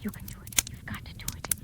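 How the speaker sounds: desperate hushed whisper to Carl; urgent whisper. Acted. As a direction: whisper so the aunt can't hear. Secret plea, aunt nearby.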